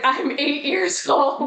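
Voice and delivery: a hint of an indignant child; mildly mock-indignant little kid; child's protest. Child's protest to the ladies acted.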